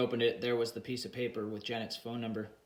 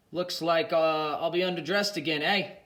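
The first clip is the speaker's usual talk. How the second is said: dry mocking aside, pitched up; sarcastic; 5 semitones higher, 6 dB louder than usual. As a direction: say it with heavy sarcasm. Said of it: "Mick said with great sarcasm".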